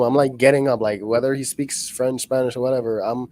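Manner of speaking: normal talking voice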